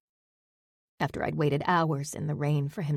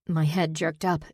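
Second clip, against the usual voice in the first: plain reading voice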